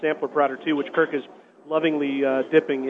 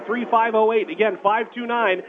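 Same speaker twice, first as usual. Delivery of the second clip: radio announcer; 4 semitones higher than usual. Acted. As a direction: pitch the contest to listeners. Radio contest call to action.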